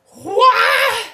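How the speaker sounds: high, loud shouted what; sudden loud startled yell; bear yelling. Acted. Bear shouting before kung fu.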